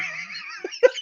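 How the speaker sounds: high wheezy squeal of laughter; helpless laugh. Real, not acted. Storyteller remembering crying with laughter, squealing laugh.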